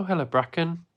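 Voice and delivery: normal talking voice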